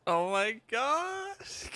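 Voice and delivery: very high, stretched-out squeal; amused disbelief. Real, not acted. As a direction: squeak it out slowly in amazement. Reacting to silly game scene.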